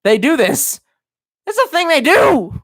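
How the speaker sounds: high, loud, climbing outburst; worked-up insistence. Acted. Streamer insisting on the conspiracy, worked up and shouting.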